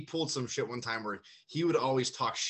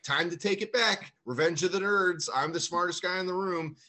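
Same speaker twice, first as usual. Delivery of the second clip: mocking teacher; 7 semitones higher, 4 dB louder than usual. Mocking the teacher's imagined motive.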